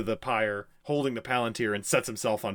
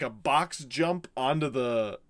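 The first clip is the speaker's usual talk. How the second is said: normal talking voice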